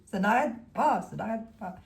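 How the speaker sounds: usual speaking voice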